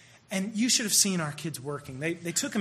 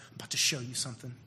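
quick then dropping to a whisper; God speaking softly; 6 semitones lower, 9 dB quieter than usual. Retold or imitated. Preacher voicing God's gentle words about the kid, whispered. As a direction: let God's voice sink to a hush. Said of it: "It was like God said".

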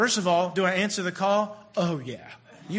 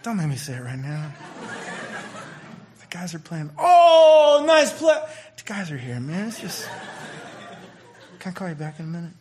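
put-on a little girlfriend voice; 4 dB louder than usual. A guy half-whispers love to his girlfriend with friends nearby, lightly voiced. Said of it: "a little bit of the girlfriend voice".

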